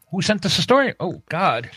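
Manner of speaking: normal talking voice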